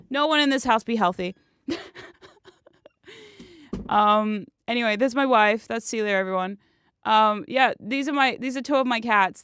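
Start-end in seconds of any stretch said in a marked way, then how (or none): none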